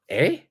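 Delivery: "Eh?" sweeping up high; a short questioning "Eh?" that rises steeply; rising intonation. Shown as a demonstration. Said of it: "intonation goes up".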